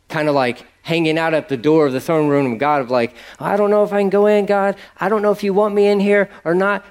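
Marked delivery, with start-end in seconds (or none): 3.4-6.9 timid prayer. Retold or imitated. Mocking timid hesitant prayer.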